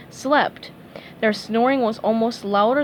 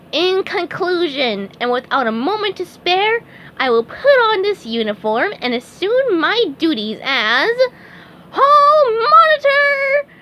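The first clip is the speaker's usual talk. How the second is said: grand finale; 9 semitones higher, 6 dB louder than usual. A narrator's reading. Speech climax.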